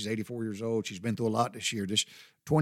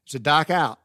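louder mock Yankee accent, "dark" as dahk; 6 dB louder than usual. Imitating Yankees asking about nightfall, r-less Yankee accent. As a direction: punch it out like a Yankee, R dropped. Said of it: "they'll say".

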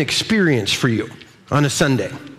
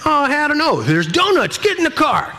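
higher, playful lure; coaxing parent; 7 semitones higher than usual. Retold or imitated. Dad luring his kids to church with donuts, higher playful coaxing. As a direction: dangle the donuts to hurry them. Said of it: "It's like".

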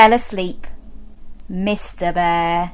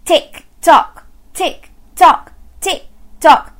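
louder clipped tick-tock; clock ticking; 7 semitones higher, 5 dB louder than usual. Acted. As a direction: tap out a clock beat. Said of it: "went the living room clock".

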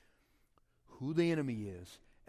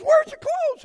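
much higher, loud shocked yell; husband's shout; 18 dB louder, slower than usual. Retold or imitated. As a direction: bark it at your wife in disbelief. Husband yells at naked wife.

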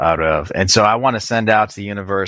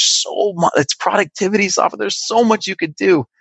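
excited pitch lift; 12 semitones higher than usual. Real, not acted. Guest lights up talking about his software, much higher excited voice. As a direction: voice jumps much higher with enthusiasm. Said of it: "your pitch and your voice just goes up an octave".

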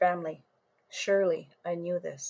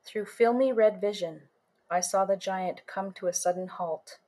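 usual speaking voice

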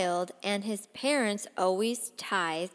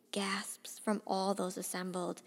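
usual speaking voice

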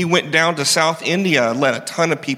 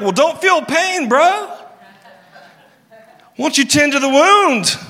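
mock dismissive advice; 10 semitones higher than usual. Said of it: "It's like telling someone".

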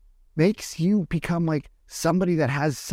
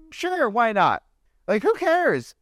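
carefree shrug; 9 semitones higher, slower than usual. Retold or imitated. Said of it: "And I'm like".

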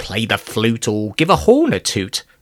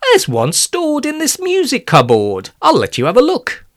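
higher and fast, eager; eager teacher; 13 semitones higher than usual. Acted. Music teacher leaps up to fetch a lute, high and fast. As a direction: rush it out keenly. Mister Day leapt up.

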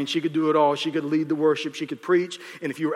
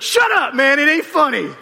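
annoyed protest; 9 semitones higher, 9 dB louder than usual. Retold or imitated. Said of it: "It's like".

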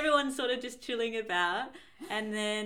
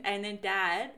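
ordinary talk, nothing marked

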